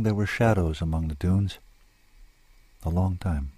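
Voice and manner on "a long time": plain reading voice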